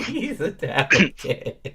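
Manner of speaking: words squeezed through laughter; slow, laughing delivery. Real, not acted. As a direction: crack up while repeating the joke. Cracking up at the joke.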